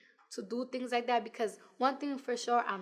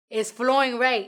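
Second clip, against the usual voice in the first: indignant retort; 9 dB louder than usual. Retold or imitated. Retold argument in the booth.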